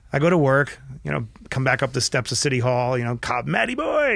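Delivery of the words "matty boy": high, hearty mock cop greeting; lightly imitated cheerful cop; imitating a cop. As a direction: call out like a friendly beat cop. Cop greets him on steps.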